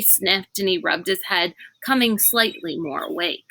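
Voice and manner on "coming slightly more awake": ordinary delivery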